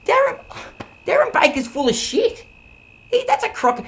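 high, loud scoffing; scornful outburst. Acted. Kane scoffing loudly at what Darren Baker said. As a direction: pour scorn on the rumour.

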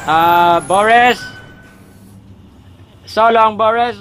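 high, loud mock-dramatic goodbye; loud farewell. Real, not acted. Companion taken away.

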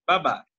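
"বাবা" as one brisk word, vowels short; quick short vowels. Shown as a demonstration. Teacher reminding student how it was read, quick short vowels. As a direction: model "বাবা" with no stretch. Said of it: "আমরা কিভাবে পড়েছিলাম".

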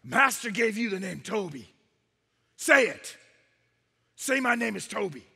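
loud, forceful order with sharp bursts; commanding slave-whipper. Acted. Man with the whip to the captured chief, commanding. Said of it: "And breathless, he says to him".

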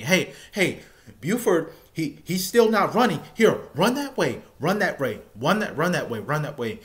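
loud, high barking orders; imitating drill instructor yelling. Drill instructor yelling at recruit to run, loud barked orders. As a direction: bark it like a drill sergeant chasing a recruit.

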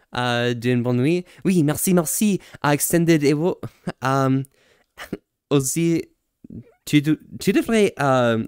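usual speaking voice

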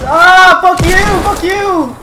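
high, loud furious yelling; raging yell. Real, not acted. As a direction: scream in rage. Raging at being killed.

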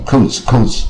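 "coats" with a long O, in place of cause; wrong long-O "coats"; uncurled mistake. Shown as a demonstration. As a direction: say the mistaken "coats" with no tongue curl. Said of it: "很多人不会卷舌".

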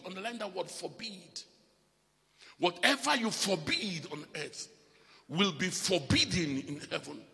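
rising, loud sermon voice; preaching. Acted. Preacher exhorting the congregation, loud and rising. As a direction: preach it out to the church.

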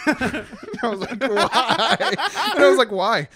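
cracking up asking why; laughing. Real, not acted. Friend laughing retelling teacher's dark warning.

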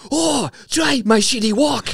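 high-pitched cartoon Chinese accent; hammed-up Chinese accent, pitched right up; mock Chinese accent. Imitating South Park creators recording, high mock Chinese accent.

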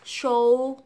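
"show" loud, closing on a dark L; tongue-up first syllable. Shown as a demonstration. Teacher reviewing syllable, loud "show" with L. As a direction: push "show" loudly into an L. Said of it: "đẩy lưỡi lên".

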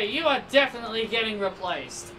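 normal talking voice

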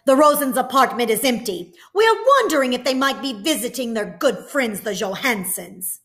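a sly, loud insinuating soldier; German soldier. A narrator's reading. Second soldier hinting the Rosens are hiding here, loud. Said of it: "Another German voice said".

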